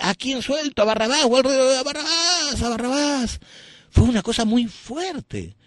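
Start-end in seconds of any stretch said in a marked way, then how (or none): 0.0-3.4 very high, chanting crowd call; imitating the crowd at the trial; crowd crying Barrabás. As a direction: cry out like the mob.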